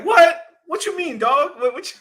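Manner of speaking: high, loud incredulous imitation; exaggerated high-pitched disbelief; mock shock. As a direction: yelp it as a stunned friend, nearly laughing. Imitates Richard's shocked reaction.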